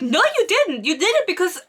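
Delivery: high-pitched argumentative comeback; mock indignant retort. Retelling his answer to her confession, mock indignant. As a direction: contradict with hammed-up indignation.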